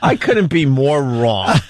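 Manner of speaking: usual speaking voice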